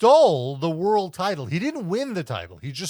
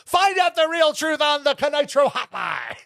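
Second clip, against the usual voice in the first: TV announcer; 9 semitones higher, 4 dB louder than usual. Acted. Mock TV promo announcer.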